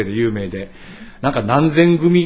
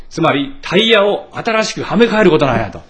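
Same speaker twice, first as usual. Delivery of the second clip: ordinary talk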